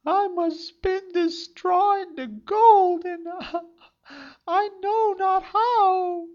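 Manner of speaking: put-on very high, loud girlish cry; high maiden voice. A narrator's reading. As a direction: cry it out like a distressed young maiden. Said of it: "said she".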